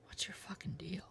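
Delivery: hushed, voiceless whisper. Acted. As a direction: whisper the question. Whispered replay of the important question.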